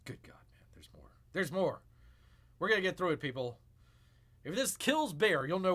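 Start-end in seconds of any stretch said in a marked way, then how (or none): 0.0-0.6 drained, breathy whisper; weary whisper. Real, not acted. Exhausted streamer sighing at the rambling video, whispered. Worn out after long clip. 0.7-1.1 soft, despairing whisper; dreading the rest of the clip. Real, not acted. Streamer warning viewers the clip goes on, whispered. Whisper then normal repeat.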